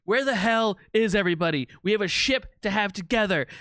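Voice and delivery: angry. Acted. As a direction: demand an answer, furious. Captain finds his crew gone.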